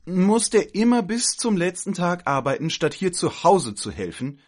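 high, loud grumbling; exasperated complaint. Acted. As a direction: complain loudly at a high pitch. Aunts complaining about the absent man. (laut und hoch klagend)

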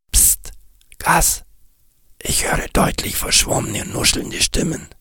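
geflüstertes Psst und Raunen; flüstere heimlich zu Kass; Flüstern. Acted. Nick hushes, hears voices. (Nick flüstert Kass ängstlich zu)

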